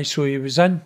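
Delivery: ordinary delivery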